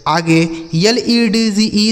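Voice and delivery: ordinary talk, nothing marked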